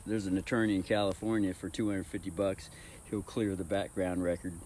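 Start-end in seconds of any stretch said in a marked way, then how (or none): none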